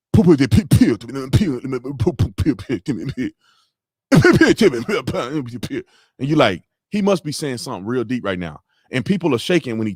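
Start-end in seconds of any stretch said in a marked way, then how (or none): 0.1-5.8 punchy gibberish speech, hard popping syllables; mock a forceful Russian speech in nonsense sounds; Putin. Streamer contrasting Putin with shaky politicians, mock-forceful Russian gibberish. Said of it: "somebody like Putin that's like".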